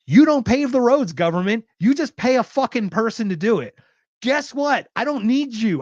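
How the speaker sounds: high, loud, furious rant; angry rant. Real, not acted. Ranting at the government over road taxes, angry and loud. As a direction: tell the government off hotly.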